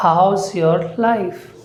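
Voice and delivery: "How's your life?" said slowly, word by word; slow careful English. Shown as a demonstration. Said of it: "ये होता है".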